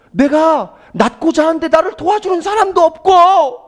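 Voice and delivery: mimicked complaint. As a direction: complain like the helpless sick man. Mimics the sick man's complaint.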